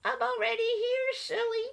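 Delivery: very high little mouse voice; squeaky mouse. A narrator's reading. Mouse answering Rabbit indoors, squeaking. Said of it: "Mouse squeaked".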